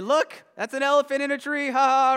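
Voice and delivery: high, loud jeering taunt; a playground jeer, high and loud; mocking animals. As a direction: laugh at the elephant in the tree. Animals mock Horton in tree.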